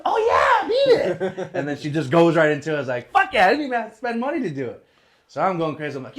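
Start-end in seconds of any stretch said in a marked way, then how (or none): 0.0-1.5 much higher, louder chirpy reply; squeaky imitation of the pianist; mock piano lady. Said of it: "She goes". 3.1-4.8 excited. Retold or imitated. Celebrating a free song.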